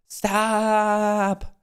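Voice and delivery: Stop. pitched high and stretched; high pleading stop. Real, not acted.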